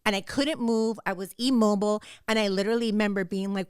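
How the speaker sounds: ordinary talk, nothing marked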